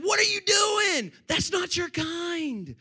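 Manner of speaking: high, drawn-out scolding yell; screaming. Retold or imitated. Pastor retells scolding his big dog, screaming. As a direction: yell it slowly at a misbehaving dog. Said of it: "I'm screaming at this dog".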